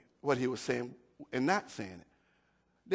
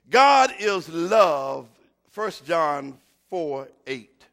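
slow emphasis; 10 dB louder, much slower than usual. Real, not acted. Slow emphatic scripture statement.

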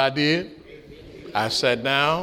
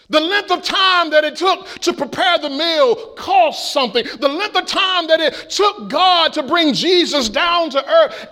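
preaching; 9 semitones higher than usual. Acted. Preaching on the cost.

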